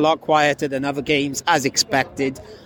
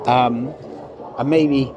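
ordinary delivery

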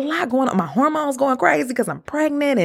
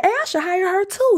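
mock impressed client; 10 semitones higher than usual. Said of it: "somebody could be like".

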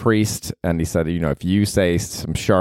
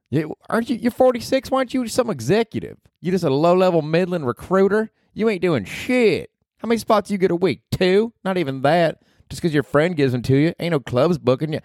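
Ruth from Ozark; 10 semitones higher than usual. Acted. Podcaster voicing Ruth, cursing at him, brash and high. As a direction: snap it like a foul-mouthed hillbilly girl. Voiced Ruth mocking career.

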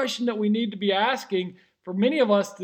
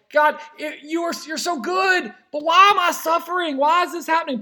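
anguished plea; 7 semitones higher than usual. Retold or imitated. Preacher voicing Martha in her grief, raised and pleading. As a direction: cry out in hurt to God. Martha voiced crying out to God.

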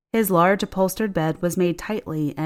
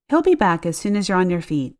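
ordinary delivery, nothing marked

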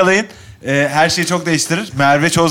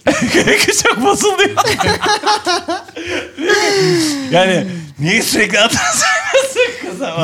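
laughing high through the words. Real, not acted. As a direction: burst out laughing while talking. Laughing about wife's jealousy.

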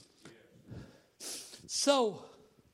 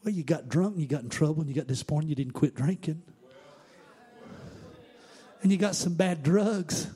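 usual speaking voice

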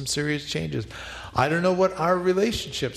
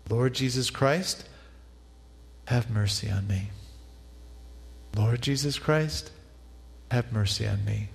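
breath prayer. Shown as a demonstration. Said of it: "pray as you breathe".

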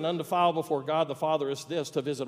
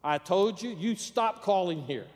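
acted angry outburst, higher pitch; exasperated phone rebuke, moderately raised voice; going off; 4 semitones higher than usual. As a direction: tell off a nuisance caller, slowing to emphasise. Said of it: "went off".

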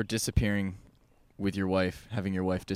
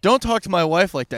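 louder, higher, hammed-up protective old man; indignant husband; 8 semitones higher, 10 dB louder than usual. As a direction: defend your wife's honour in a booth. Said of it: "her husband turns around and goes".

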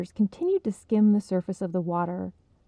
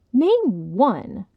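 louder, daring demand; challenging; 3 semitones higher, 7 dB louder than usual. Acted. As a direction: throw down the challenge. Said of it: "Esther challenged".

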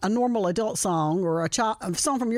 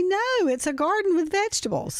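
put-on child voice; 9 semitones higher than usual. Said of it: "And they'll say".

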